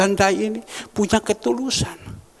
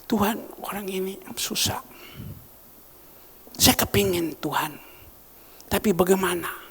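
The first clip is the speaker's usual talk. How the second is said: whispered prayer; 6 dB quieter than usual. Retold or imitated. His whispered prayer to God.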